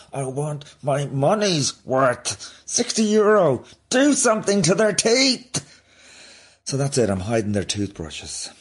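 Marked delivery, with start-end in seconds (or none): 0.1-5.8 high-pitched, exasperated mock outrage; outraged complaint. Parent griping about sixty euro at the dentist, mock outrage. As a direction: rant it out, stretching the words in indignation.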